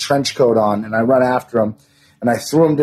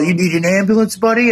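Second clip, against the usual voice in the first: high, sneering imitation of the cop; mocking cop voice; 8 semitones higher than usual. Retelling how the cop taunted him after the fight, mock high sneer. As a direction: mimic the cop with a lot more pitch. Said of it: "He's all".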